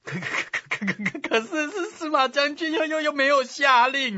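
very high, stuttering protest; hesitant stammer. A narrator's reading. 陈纪 objecting that no order was given, high stammer. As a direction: squeak out a faltering objection, repeating words. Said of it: "这个陈纪犹豫不决啊".

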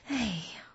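soft, whispered sigh; exasperated sigh. Acted. Bear waits at a closed door, sighing. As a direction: let out a breathy, impatient sigh.

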